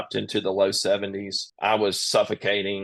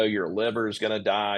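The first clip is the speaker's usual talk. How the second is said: usual speaking voice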